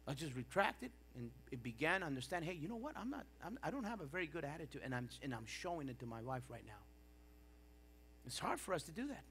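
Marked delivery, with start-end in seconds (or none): 2.5-6.8 quiet realization. Retold or imitated. Acts out his own quiet realization.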